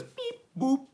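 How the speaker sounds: mock robot beep-boop. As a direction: play it like a little chat robot. Reading a bot's chat message.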